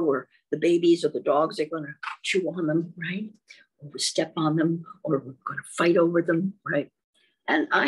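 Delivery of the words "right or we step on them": ordinary delivery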